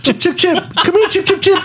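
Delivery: high, sing-song chicken call. Retold or imitated. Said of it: "That's what she calls her chickens".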